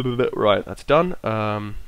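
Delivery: ordinary talk, nothing marked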